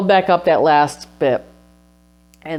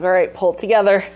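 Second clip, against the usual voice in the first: brisk, higher, louder scolding herself; self pep talk; 6 semitones higher, 4 dB louder than usual. Retold or imitated. Telling herself to stop crying, a brisk higher scold. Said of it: "so I was like".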